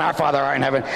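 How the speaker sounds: hurried rote recitation; real fast. Acted. Penitent adding another Our Father to be safe, real fast. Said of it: "you're saying it real fast".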